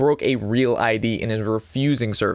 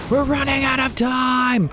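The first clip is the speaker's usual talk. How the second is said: high, slow panicked shout; 13 semitones higher, slower than usual. Real, not acted. Streamer to chat as the turn timer ends, real panicked shout. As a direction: yell it as the clock runs out.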